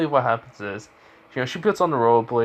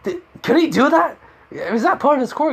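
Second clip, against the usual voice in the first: very high, loud astonished questioning; incredulous; 14 semitones higher, 4 dB louder than usual. Acted. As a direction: squeal the question in disbelief. Acted astonished question to colleague.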